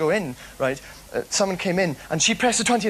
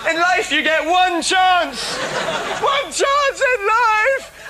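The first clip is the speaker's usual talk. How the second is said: slow, louder, higher laughing shout; 10 semitones higher, 6 dB louder, much slower than usual. Real, not acted. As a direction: bellow it out, half laughing. Shouting his missed chance, laughing.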